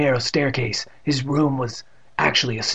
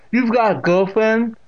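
high, loud deaf great-aunt's voice; Catherine; 10 semitones higher, 5 dB louder than usual. A narrator's reading. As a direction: quiz him as the deaf aunt, pitched up. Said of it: "with that distinct pronunciation".

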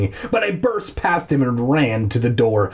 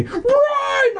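very high, over-the-top shriek; screamed command. Acted. Boy screaming at his hesitating dog to flee. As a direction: scream it at the top of your range. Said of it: "I screamed".

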